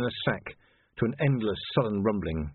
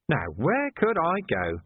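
grinning; 7 semitones higher than usual. A narrator's reading. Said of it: "grinning at him".

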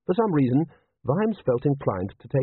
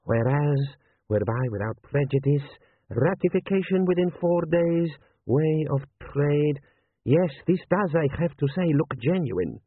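lightly voiced general advising his prince; cautious, respectful military adviser; deferential general; 4 semitones higher than usual. General reads the document aloud.

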